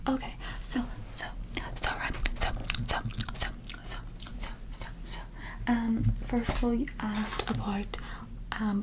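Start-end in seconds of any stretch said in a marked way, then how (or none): 0.6-5.2 tingly asmr mouth sounds. Acted. Repeated trigger sounds.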